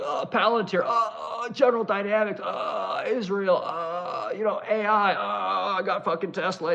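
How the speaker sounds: high, put-on voice of a distracted president listing donors, halting on each uh; mock Trump rambling. Streamer mocks the president reciting corporate backers, high halting voice. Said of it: "he's like".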